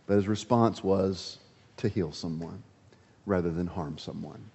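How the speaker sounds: hushed. Acted. Preacher drops to soft point.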